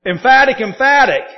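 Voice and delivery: "emphatic, emphatic" punched high and loud, twice; doubled emphasis. Shown as a demonstration. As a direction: hit "emphatic" hard both times.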